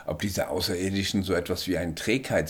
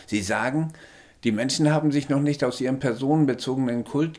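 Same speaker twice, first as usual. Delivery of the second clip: higher little alien voice, matter-of-fact; small alien; 7 semitones higher than usual. A narrator's reading. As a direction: speak as the tiny alien, calm and sure.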